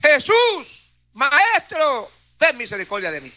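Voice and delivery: high cry that falls away. Acted. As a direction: call out high, then let it drop. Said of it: "alzaron la voz diciendo".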